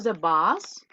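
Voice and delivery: the bus with a clear open vowel, de bas. Shown as a demonstration. Teacher models bus the vehicle, open vowel. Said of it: "¿logran identificar un poquito la diferencia?".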